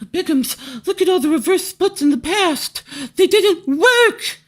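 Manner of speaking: high, nasal-free mock taunt of a whiny critic.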